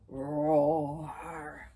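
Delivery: low growly roar; mock bulldozer roar. Reader voicing the bulldozer's thunder, low roar. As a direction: roar like a big machine.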